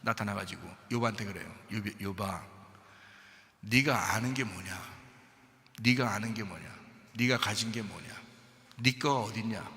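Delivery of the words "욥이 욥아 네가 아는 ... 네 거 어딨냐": softer, lower, grave voice of God; a hushed, deep God confronting Job; solemn God. Acted.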